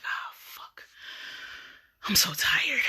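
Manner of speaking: exhausted whispered curse; tired whisper. Retold or imitated. Missing the bus, she whispers to herself wearily. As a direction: breathe it out, spent and muttering to herself. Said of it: "and I'm like".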